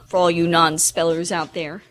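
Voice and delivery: ordinary talk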